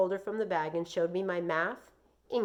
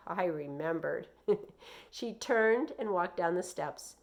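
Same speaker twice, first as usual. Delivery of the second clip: ordinary delivery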